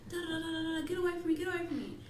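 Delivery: imitating a woman fending off a creep; retold panicked protest. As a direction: voice her backing off from him, pitch up. Woman pushing Chris away, retold.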